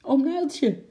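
a high, loud call to her uncle; girl calling quickly to her uncle. Acted. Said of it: "riep Lotje snel".

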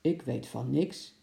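put-on slow, understated denial as an old attendant; a slow, slightly put-on grandpa voice; lightly voiced grandpa. Grandpa attendant to an upset customer, slow understated denial. Beleaguered attendant denies knowing anything.

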